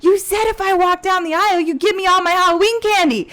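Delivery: put-on high, loud, whiny kid demanding candy; angry little boy. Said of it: "he's like".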